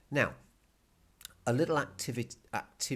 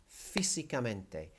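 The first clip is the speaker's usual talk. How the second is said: "físicamente" with the opening FÍ hit; stress on first I. Shown as a demonstration. Teacher repeating for students, "físicamente" stressed on FÍ. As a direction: lean on FÍ in "físicamente", then fall away. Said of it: "you hit that first I".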